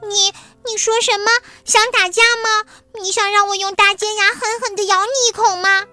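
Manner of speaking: high, loud, fast mouse threat; fast furious squeak; angry mouse. Acted. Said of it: "两只小老鼠吵了起来".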